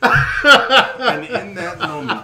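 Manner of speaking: loud, very slow, laughing declaration; slow laughing mock-dramatic line; mock epic pronouncement. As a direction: intone it like a grand prophecy through laughter.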